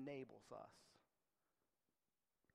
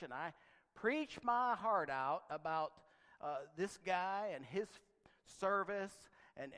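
louder, fervent preaching build; 3 semitones higher, 9 dB louder than usual. Acted. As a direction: preach it out to the congregation. Pastor retelling first sermon, preaching.

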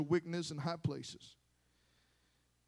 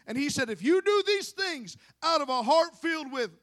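loud, high-pitched preacher delivery; impassioned preaching; 9 semitones higher, 7 dB louder than usual. Real, not acted. Preaches at raised force.

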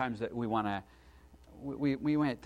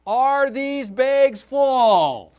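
much higher and louder, each word drawn out; loud slow repeat for the hard of hearing; 12 semitones higher, 14 dB louder, much slower than usual. Retold or imitated. Father repeating his question to a counter girl, slow and loud. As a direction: spell it out loudly as if she can't hear. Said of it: "thought that she was hard of hearing".

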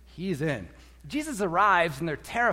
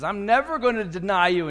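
loud, emphatic vow; insistent protest; 3 semitones higher, 7 dB louder than usual. Acted. Peter arguing with Jesus that he will stay loyal, insistent. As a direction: swear it forcefully.

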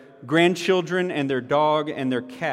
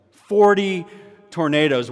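Forty punched higher and louder; stress on Forty; 3 semitones higher, 5 dB louder than usual. Acted. Preacher to congregation, hitting the word Forty hard. Repeating the number for emphasis.

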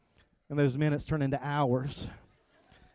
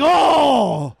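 exasperated outburst; 8 semitones higher, 14 dB louder than usual. Retold or imitated. Told to wait again.

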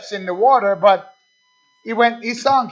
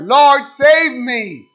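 drawn-out, higher, louder plea; crying out to the Lord; 5 semitones higher, 5 dB louder, much slower than usual. Retold or imitated. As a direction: cry out slowly for rescue, as if sinking. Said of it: "When he cried out".

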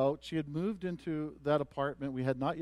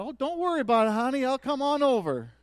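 put-on high, loud Southern accent; Mildred; 10 semitones higher, 10 dB louder than usual. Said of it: "in her southern accent".

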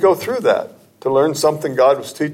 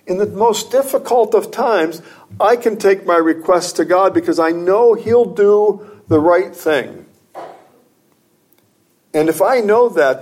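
higher, rising pulpit fervour; preaching; 6 semitones higher than usual. Acted. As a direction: lift the congregation with earnest conviction. Preacher lifts voice again.

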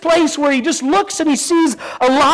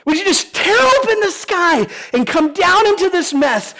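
pleading prayer; 7 semitones higher than usual. Retold or imitated. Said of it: "his prayer is literally".

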